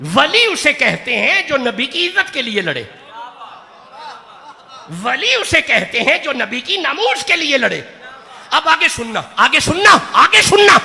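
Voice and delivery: loud, fast exhortation that builds; impassioned call to the audience; rousing preaching. Acted. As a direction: whip up the crowd to shout. Preaching, urging the crowd.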